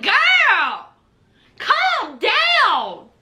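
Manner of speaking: drawn-out, swooping mock admonishment; playful retold scolding; imitating exasperated homegirls. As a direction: stretch each word as a friend reining someone in. Homegirls calming her, acted out.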